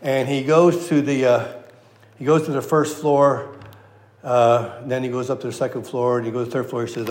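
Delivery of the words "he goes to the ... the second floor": usual speaking voice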